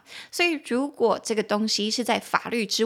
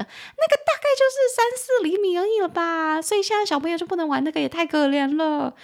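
mock pity; 8 semitones higher than usual. Real, not acted. Playful aside about kids and glitter.

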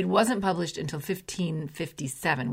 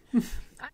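amused laugh; 8 semitones higher, 3 dB quieter than usual. Real, not acted. Host amused by archaic word.

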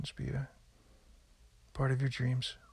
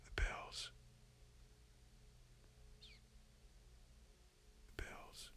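fading whisper; 19 dB quieter, slower than usual. Acted.

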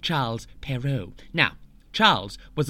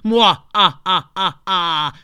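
loud hammed-up villain cackle; evil laugh; 2 semitones higher, 9 dB louder, slower than usual. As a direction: cackle louder, gloating.

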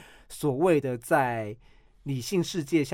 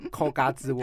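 laughing. Real, not acted. Joking about Hyun Bin.

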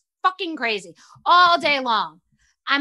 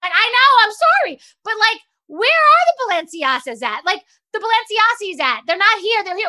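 high, loud flustered apology; panicked apology; 8 semitones higher, 6 dB louder than usual. Retold or imitated. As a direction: scramble to apologise. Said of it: "I'm like".

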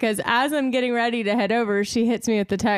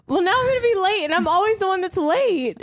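exasperated; 7 semitones higher than usual. Retold or imitated. Retold exasperated reply.

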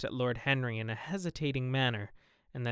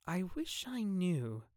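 soft, slow and higher; a slow, hushed sigh of doubt; wistful; 7 semitones higher, 4 dB quieter, slower than usual. A narrator's reading. Duchess wistful answer.

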